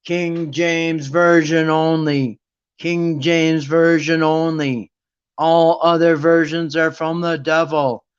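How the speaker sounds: slow, droning mindless chant; brainwashed zombie. Acted. Church follower parroting what leaders said, brainwashed zombie chant. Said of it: "people are brainwashed like zombies".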